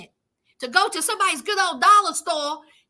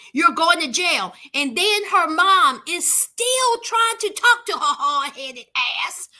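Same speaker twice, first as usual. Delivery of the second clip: ordinary talk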